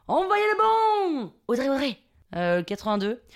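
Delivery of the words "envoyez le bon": high, slow southern French holler; hammed-up southern French accent. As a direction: bellow a bad imitation of Marseille accent. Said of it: "Je prends mon plus bel accent du Sud". (faux accent du Sud, crié et traînant)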